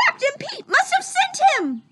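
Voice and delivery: very high squawking duck voice; Donald Duck panic. Acted. Donald in the cell fears Pete's plot, squawking. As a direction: quack it out in fear.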